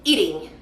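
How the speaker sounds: "eating" ending in a clear "ing" with a falling tune; "eating" set against "eaten", stressing its "ing" ending. Shown as a demonstration. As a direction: let "eating" end on "ing". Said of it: "Escuchen la diferencia de eaten".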